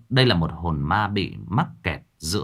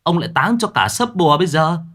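threatening; 8 semitones higher, 4 dB louder than usual. A narrator's reading. Mạc threatens the ghost.

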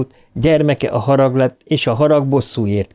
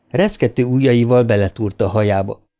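plain reading voice

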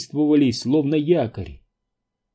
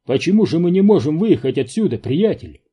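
quick, higher, louder question; puzzled surprise; 5 semitones higher, 5 dB louder, faster than usual. A narrator's reading. Зеро asks driver why they are stuck, surprised. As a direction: demand to know, baffled. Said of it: "удивился Зеро".

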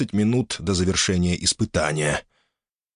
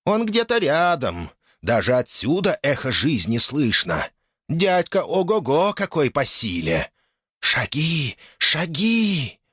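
excited spirit; 7 semitones higher, slower than usual. Acted.

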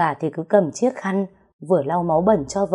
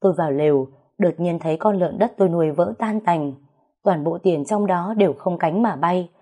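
plain reading voice

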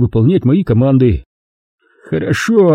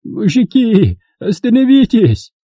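hoarse gasp; 11 semitones higher, slower than usual. A narrator's reading. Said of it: "прохрипел он, почти теряя сознание".

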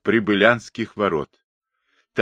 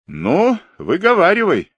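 high, raised, daring call; shouted challenge; 10 semitones higher, 4 dB louder than usual. A narrator's reading. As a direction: dare the man to speak up. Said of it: "крикнул Тимошкин сын". (окрик с вызовом, высоким голосом)

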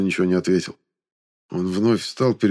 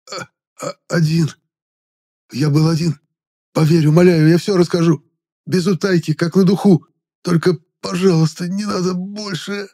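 high, breaking, tearful begging; sobbing plea; 9 semitones higher, 4 dB louder than usual. A narrator's reading. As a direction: beg through sobs. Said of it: "Рафайн разрыдался в голос".

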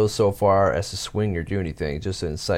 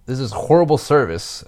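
mock angry customer; 5 semitones higher, 5 dB louder than usual. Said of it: "starts in on me angrily".

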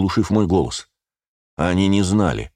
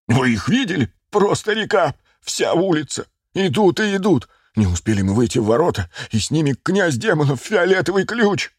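высокий перепуганный голос; выкрикивай донесение в ужасе, высоко; паника; 10 semitones higher than usual. A narrator's reading. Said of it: "от страха вспотеть генерала". (панически докладывает)

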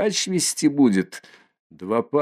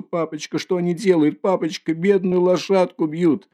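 put-on child's plea; 8 semitones higher than usual. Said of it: "кричит он отцу".

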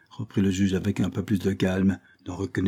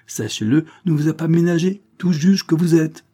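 rising, louder and higher to the end; indignant rebuke; 8 semitones higher, 6 dB louder than usual. A narrator's reading. Carlyle scolding the judge, building to loud indignation. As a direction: build up to an angry reproach.

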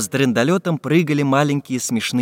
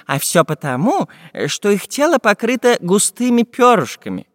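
lightly voiced professor, higher-pitched and emphatic; learned professor explaining; 7 semitones higher than usual. Professor tells the travellers about Antarctica, higher-pitched lecturing. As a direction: explain it keenly in a slightly put-on scholar's voice.

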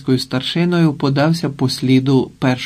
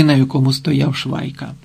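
usual speaking voice

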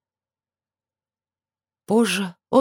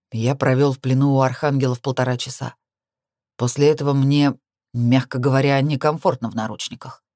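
low, level man's voice, uncomfortable; reproachful male lover, restrained; 4 semitones lower than usual. Acted. Handcuffed lover explains his discomfort to heroine, low restrained reproach. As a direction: speak lower, as the displeased lover.

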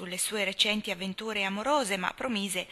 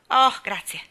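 loud, higher, ungracious "grazie"; grudging thanks; 4 semitones higher, 10 dB louder than usual. A narrator's reading. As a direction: thank her as if it costs you. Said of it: "di mala grazia". (grazie di mala grazia, forte e sgarbato)

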